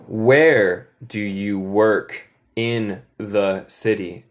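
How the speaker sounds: very slow, each word spaced out; slow teacher model for repeating; slow model phrase. Shown as a demonstration. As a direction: say it slowly for the class to repeat. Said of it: "Repiten después de mí".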